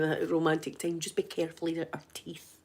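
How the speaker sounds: low, very soft, discreet caution; hushed warning. Acted. Warning a partner during a romantic time, hushed. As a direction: murmur a careful warning to a lover.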